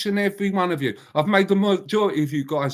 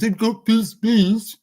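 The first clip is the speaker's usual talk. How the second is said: mimicking a stiff politician, pitched up and louder; mocking Keir's voice; 6 semitones higher, 4 dB louder, slower than usual. Mocking the Prime Minister's halting speech to the chat. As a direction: poke fun at how he talks. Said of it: "It must annoy him the way he sounds".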